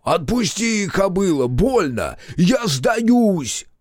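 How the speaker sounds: high, pained surrender yell. A narrator's reading. Captive hussar surrendering to the centauress, yelling in pain. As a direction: holler to be let go. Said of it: "орал краснозадый на всю долину".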